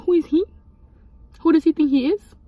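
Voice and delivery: high-pitched, incredulous challenge; indignant retort. Retold or imitated. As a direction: act out scoffing at someone's authority. Retells own indignation about the guard.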